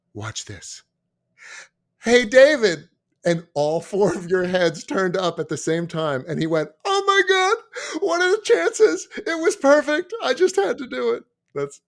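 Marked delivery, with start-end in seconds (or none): 0.1-0.8 soft, hushed setup for a prank; conspiratorial aside. Retold or imitated. 2.0-2.9 loud, high mock call down the stairs. Retold prankster calls down the stairs. 6.8-10.1 very high, loud, slow squeal of delight; gleeful exulting. Retold or imitated. Retold prankster exults over his success. 10.2-11.2 high-pitched imitation of a thrilled prankster; gleeful exulting. Acted exulting, later repeated plainly.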